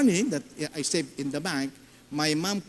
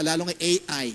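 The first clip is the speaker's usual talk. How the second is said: ordinary talk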